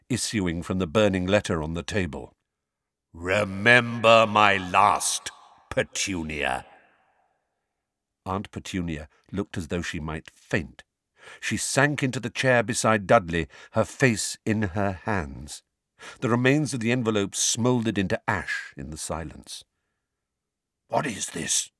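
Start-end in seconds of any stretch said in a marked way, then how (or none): 3.1-6.6 slow, eerie, echoing voice from the letter; awful voice. A narrator's reading. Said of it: "An awful voice filled the kitchen". 20.9-21.7 hoarse. A narrator's reading. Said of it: "Uncle Vernon said hoarsely".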